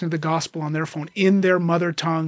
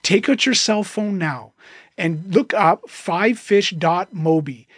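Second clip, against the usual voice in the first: slow and deliberate, word by word; slow, spelled-out instruction; slower than usual. Shown as a demonstration. Host tells listeners the app name, slow and deliberate. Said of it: "instructs listeners to look up".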